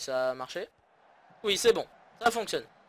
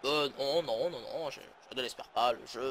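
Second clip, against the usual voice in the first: a hint of a pundit answering, lower; lightly voiced co-commentator; 4 semitones lower than usual. Commentator answering his partner Arsène, lightly voiced, lower. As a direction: play the co-commentator replying calmly. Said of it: "N'est-ce pas, Arsène?".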